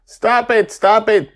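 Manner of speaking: high, loud repeated plea; pleading shout. Acted. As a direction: beg them to stop. Laughing crowd, pleading.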